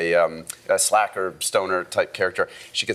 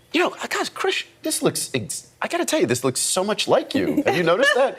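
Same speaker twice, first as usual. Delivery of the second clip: quoting Patrick; 12 semitones higher than usual. Retold or imitated. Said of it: "and he said".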